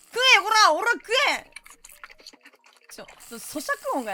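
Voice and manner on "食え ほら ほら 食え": high, loud bossy yell; mock shout. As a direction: order them to eat. Forcing meat on customer. (「食え」と怒鳴る)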